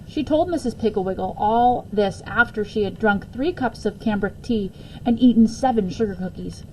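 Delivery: usual speaking voice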